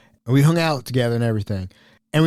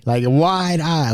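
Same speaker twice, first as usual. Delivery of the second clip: normal talking voice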